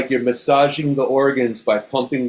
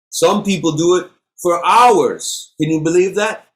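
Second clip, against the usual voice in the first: excited; 6 semitones higher, 4 dB louder than usual. Real, not acted. Marvels at hours of practice.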